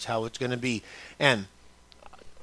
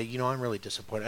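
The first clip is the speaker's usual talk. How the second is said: quiet disappointment; 5 semitones lower, 12 dB quieter, faster than usual. Acted. Parent gently voicing disappointment to child.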